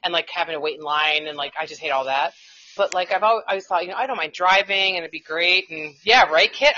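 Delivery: usual speaking voice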